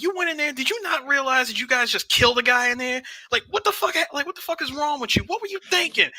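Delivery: high, heated scolding of a son; scolding father. Retold or imitated. Angry father scolding his son over the killing.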